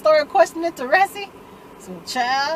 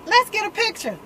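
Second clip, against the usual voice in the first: imitating him, pitched way up; high-pitched chirp; 9 semitones higher than usual. Retold Tate asking for a picture, high-pitched mimicry. Tate gushing for a photo, acted.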